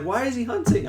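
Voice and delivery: high-pitched retold question; mock puzzlement, pitched right up; imitated puzzled Mom. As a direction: ask it in a high, querying voice. Retells Mom's puzzled question.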